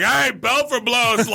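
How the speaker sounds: high, drawn-out mock page; imitated calling out. Donut shop worker unwittingly pages the prank name, high imitated call-out. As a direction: call it across the shop, stretched out.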